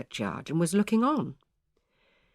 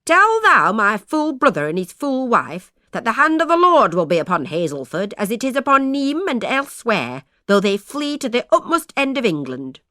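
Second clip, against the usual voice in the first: loud, high doom-laden cry; prophesy doom with scorn; defiant prophecy; 5 semitones higher, 6 dB louder than usual. A narrator's reading. Aunt defiantly cursing her fleeing brother's family. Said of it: "cried Agnes Sheldon".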